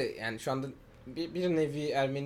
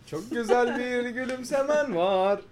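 slow, drawn-out, higher flirty compliment; flirty line; 7 semitones higher, much slower than usual. Pretend. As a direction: lay it on thick, slowly, like a cheesy pickup line.

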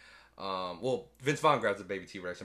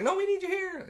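much higher pleading voice; mock high voice; 5 dB louder than usual. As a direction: plead in a squeaky voice. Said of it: "He's like".

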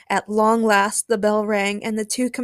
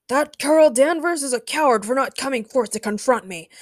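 higher, mildly shouted challenge; shouted taunt; 5 semitones higher than usual. A narrator's reading. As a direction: call out the coward publicly.

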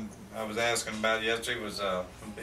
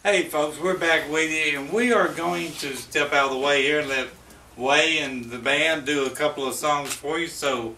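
louder, higher host announcement; radio announcer; 3 semitones higher, 6 dB louder than usual. Acted. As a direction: announce the act to the room.

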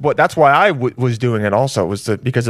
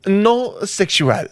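put-on French accent; 6 semitones higher, much slower than usual. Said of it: "first date with a French person".

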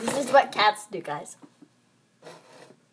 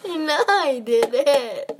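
laughing; 6 semitones higher, 7 dB louder, much slower than usual. Real, not acted. Laughing denial.

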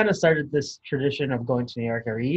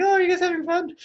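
a much higher, sing-song imitation of mom; imitating mom; faster than usual. Imitating mom asking her drunk kids if they're having fun, high and sing-song. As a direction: pitch way up and play the cheery mom checking in.

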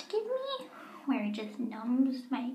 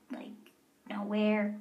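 ordinary talk

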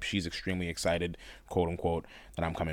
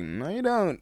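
high-pitched contradicting comeback; mock retort; 12 semitones higher than usual. Answering the friend's denial, mock contradicting. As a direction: throw it back in a high mock voice.

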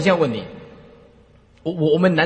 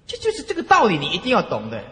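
ordinary delivery

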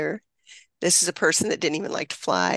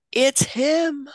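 mild mock scream; 6 semitones higher than usual. Mother recalls finding her son: a mild mock scream. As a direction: relive the shout of recognition, half-volume. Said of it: "I screamed".

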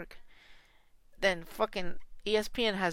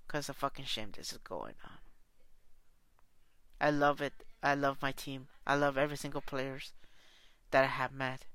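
subdued sadness; 7 semitones lower, 5 dB quieter than usual. Real, not acted. Subdued closing about his team.

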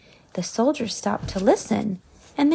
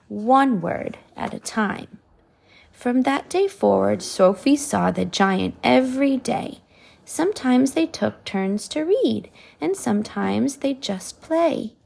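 ordinary delivery

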